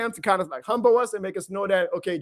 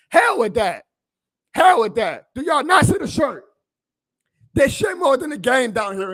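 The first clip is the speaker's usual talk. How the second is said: loud, punching HELL, then higher on the question; real fan anger, raised voice; defiant shout; 5 semitones higher, 5 dB louder than usual. Real, not acted. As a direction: wave off the critics with a yell. Rejecting critics, fired up.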